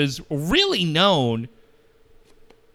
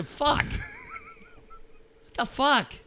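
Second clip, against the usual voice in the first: much higher, softer, stretched-out "What the fuck"; stunned squeal; 7 dB quieter, much slower than usual. Retold or imitated. Fan hearing the coach's remark, drawn-out high disbelief. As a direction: drag it out in a high disbelieving wail. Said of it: "I just go".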